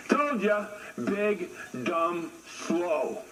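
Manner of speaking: usual speaking voice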